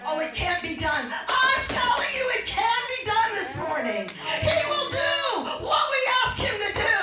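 very high shouted revival cry; full-force preaching. Real, not acted. Preacher assuring church God will move the mountain, full-force preaching. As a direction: proclaim it at the top of the voice.

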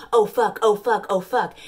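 mock climax. A narrator's reading. Reading the heroine's climax, hammed-up moaning curses. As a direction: ham up her climax. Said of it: "curses slipping past her teeth".

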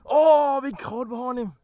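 sudden loud yelp of surprise; shouting in surprise at a big fish; startled shout. Real, not acted. Startled yelp as a big cod appears behind the fish.

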